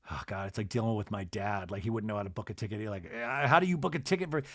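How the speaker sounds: soft, fast, exasperated aside; quiet, fast grumbling aside; exasperated mutter. Real, not acted. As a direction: grumble under your breath about dad. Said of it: "exasperated aside about dad".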